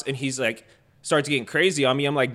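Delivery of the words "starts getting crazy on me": usual speaking voice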